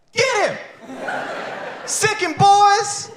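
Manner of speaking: very high, slow gleeful shout; cheering the cops on. Retold or imitated. Comic rooting for the cops on the subway, high gleeful shout. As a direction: egg the cops on with glee. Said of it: "But also".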